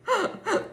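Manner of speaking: sudden high squealing laugh. Real, not acted. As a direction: burst out laughing at yourself. Laughing at own joke.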